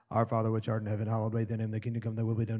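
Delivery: low, monotone mock recitation; rote recitation. Preacher imitating people who just recite the prayer, droning by rote. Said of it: "you're just reciting stuff".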